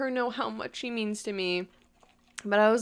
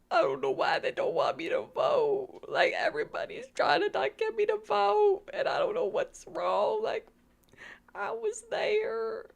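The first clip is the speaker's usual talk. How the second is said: high-pitched, hammed-up New York accent; bad New York accent; 12 semitones higher than usual. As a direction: put on a bad New York accent, sliding toward Southern. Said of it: "really bad New York accent".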